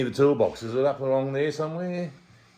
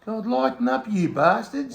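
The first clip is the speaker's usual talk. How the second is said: mock outrage; 9 semitones higher, slower than usual. Outraged at the sponsor.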